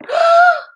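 big high mock gasp; playful teacher's gasp of surprise; surprised gasp. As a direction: gasp loudly at the reveal.

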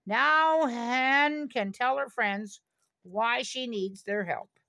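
ordinary delivery, nothing marked

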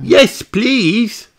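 a bright piglet explaining; Peppa's voice. Acted. Peppa explaining the walk to Granny, high and chirpy. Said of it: "says Peppa".

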